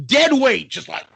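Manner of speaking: ordinary talk, nothing marked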